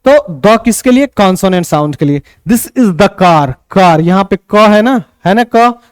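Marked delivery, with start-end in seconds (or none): 2.5-3.5 "the" said short as द before car, very slow; slow example sentence. Shown as a demonstration. Teacher reading "the car" slowly with short द. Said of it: "तो द car".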